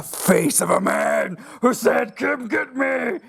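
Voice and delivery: high slow scary shout; jump-scare shout, slow and high; playful spooky voice. Acted.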